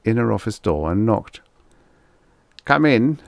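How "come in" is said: put-on German accent. A narrator's reading. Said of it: "answered a German accent".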